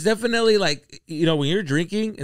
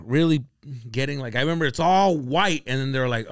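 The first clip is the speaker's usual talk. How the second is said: ordinary talk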